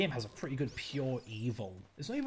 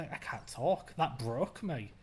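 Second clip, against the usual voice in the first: ordinary talk, nothing marked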